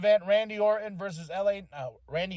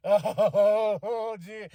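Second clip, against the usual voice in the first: laughing; 4 semitones higher, 4 dB louder than usual. Real, not acted. Laughing after his shriek.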